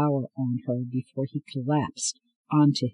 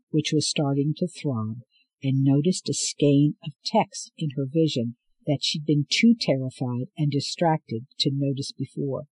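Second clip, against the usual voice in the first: ordinary delivery, nothing marked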